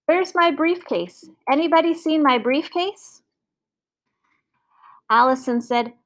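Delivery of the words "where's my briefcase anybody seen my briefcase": high-pitched call through the house; calling from upstairs. A narrator's reading. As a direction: call out higher, searching for something. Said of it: "called from upstairs".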